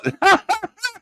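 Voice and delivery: high burst of laughter; laughing. Real, not acted. Host amused by the silly voice, high laughter. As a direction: crack up at your own bit. Cracking up at own impression.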